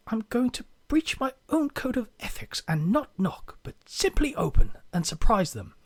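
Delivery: hushed documentary-narrator whisper; breathy hush, lifting in pitch; David Attenborough. Acted. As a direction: whisper it like a wildlife presenter. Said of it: "his best David Attenborough impression as he whispers".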